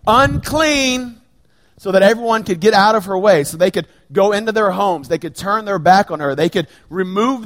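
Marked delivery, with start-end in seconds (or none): 0.1-1.2 higher, cried-out warning of an outcast; calling out unclean. Retold or imitated. Unclean woman warning the city crowd, higher and called out. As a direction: call it out like a leper warning the street. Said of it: "she would have had to call out".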